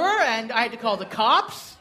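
ordinary delivery

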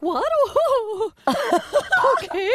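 high shocked squeal; prim, scandalized surprise; shocked gasp. Retold or imitated. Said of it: "I'm like a school teacher".

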